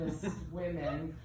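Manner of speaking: usual speaking voice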